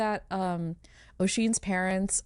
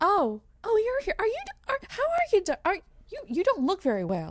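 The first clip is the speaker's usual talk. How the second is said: much higher, faltering surprise; startled warrior character; 14 semitones higher than usual. Acted. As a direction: stumble over the words in a high, concerned voice.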